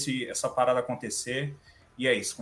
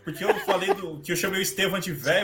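laughing while talking; 5 semitones higher, 6 dB louder than usual. Real, not acted. Laughing while telling the story.

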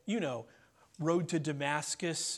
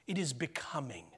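hushed; 5 semitones lower, 6 dB quieter than usual. Acted. Repeating key line, dropping voice.

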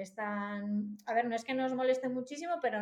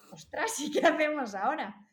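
raised, mock-panicky question; mildly hysterical; 5 semitones higher, 5 dB louder than usual. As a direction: replay a flustered mother's worry. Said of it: "Yo, claro, yo histérica". (agobio un poco histérico, recreado)

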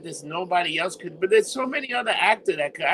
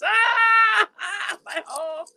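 scream, then whimper the words in comic pain; Nia Jax scream; 12 semitones higher, slower than usual. Retold or imitated. Wrestler crashing on the hardest ring part, screaming. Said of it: "the scream that comes out".

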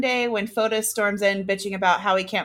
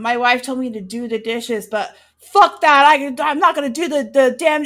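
defiant husband; 3 semitones higher, 6 dB louder than usual. Retold or imitated. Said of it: "the guy and he's like".

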